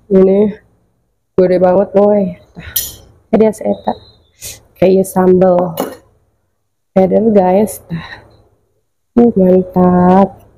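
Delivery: usual speaking voice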